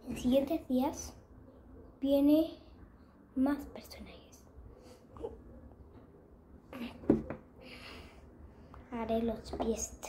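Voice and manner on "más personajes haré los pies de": soft unvoiced whisper. Real, not acted. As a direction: whisper it secretly. Whispered aside.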